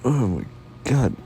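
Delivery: loud, high yawn through the words; talking through a yawn. Real, not acted. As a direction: stretch the words out in a big yawn. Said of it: "Stop yawning".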